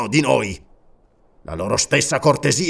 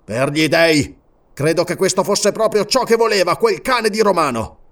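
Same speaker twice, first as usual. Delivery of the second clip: furious tirade; 7 semitones higher than usual. A narrator's reading. King to servant, raging over the crucified man. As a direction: vent his fury at Rome. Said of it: "in preda al furore".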